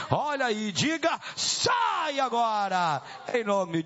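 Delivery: rally the crowd, stretching the final order; ringing cry to cast out a demon; exorcism command. Real, not acted. Rallies congregation to cast out demon.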